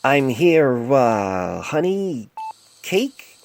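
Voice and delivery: hesitant, rising on honey cake; unsure voice. A narrator's reading. Painless guessing at the patient's name, unsure. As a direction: try the odd name uncertainly. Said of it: "tried again".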